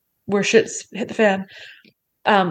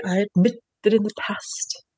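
whispered, unvoiced line; whispered quote; 3 dB quieter than usual. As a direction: breathe it out as a whisper. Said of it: "I always think of Little Mermaid".

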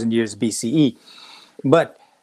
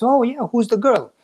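higher, eager question from an intrigued minister; a bright, higher, amused question; curious surprise; 9 semitones higher than usual. Retold or imitated. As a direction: perk up with nosy curiosity. Minister asks about prince's love.

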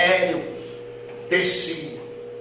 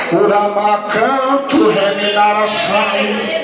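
slow, loud ecstatic syllables; speaking in tongues; 3 semitones higher, 8 dB louder, much slower than usual. Acted. Pastor breaking into tongues before the congregation, slow loud chant. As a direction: pour out the tongues slowly.

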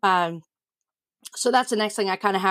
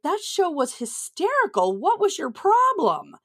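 amused disbelief; 9 semitones higher, slower than usual. Retold or imitated. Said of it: "I watched it and I'm like".

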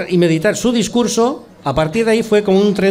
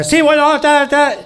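much higher, louder cartoon Basque accent; a pitched-up comic Basque accent from a TV sketch; exaggerated Basque accent; 11 semitones higher, 4 dB louder, much slower than usual. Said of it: "y yo empecé".

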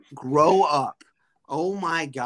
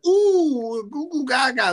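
much higher, louder cooing nonsense; baby talk; 11 semitones higher, 5 dB louder, slower than usual. Pretend. Host mocking a fan with car keys, loud high baby talk. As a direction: coo at them like at an infant. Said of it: "and be like".

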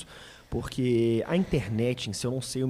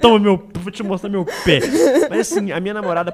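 laughing; 5 semitones higher, 8 dB louder than usual. Real, not acted. Laughing about showing foot.

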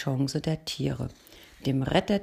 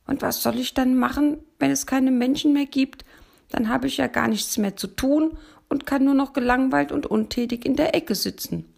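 higher, louder fretting donkey voice; donkey puzzling it out, pitched up; worried donkey; 8 semitones higher, 5 dB louder than usual. A narrator's reading. Donkey objects to the bear, higher and louder.

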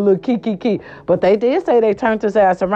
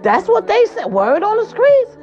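gossipy; 10 semitones higher than usual. Real, not acted. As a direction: savor the juicy rumor. Gossip catchphrase.

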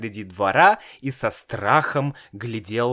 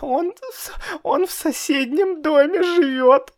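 tearful. A narrator's reading. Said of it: "сказал Славик и заплакал".

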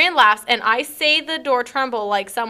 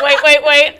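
laughing wait; 3 semitones higher, 10 dB louder than usual. Retold or imitated. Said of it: "That's why I was like".

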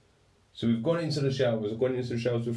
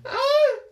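shrill yelp, far higher and louder; high-pitched scream; 8 dB louder than usual. Retold or imitated. Freezing shower hits him, sudden high yelp. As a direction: scream from the shock of the water. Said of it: "I've screamed like a little bitch".

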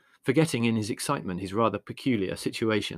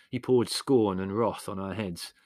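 ordinary delivery, nothing marked

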